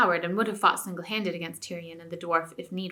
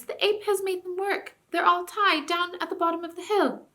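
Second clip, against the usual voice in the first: much higher squeaky mouse voice; little mouse voice; 10 semitones higher than usual. A narrator's reading. Mice telling the king about the horses, squeaky voice. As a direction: keep the tiny eager mouse squeak.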